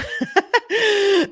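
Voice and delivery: sudden high laugh; high burst of laughter. Real, not acted. Speaker laughing at her own edgy language, high laugh.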